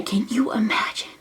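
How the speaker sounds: hushed, awed whisper. Real, not acted. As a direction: breathe it out in wonder. Whispered aside to viewers.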